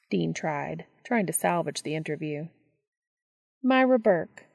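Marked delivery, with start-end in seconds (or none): 3.6-4.5 higher, clipped and unfriendly; a hint of a curt neighbor; curt older woman.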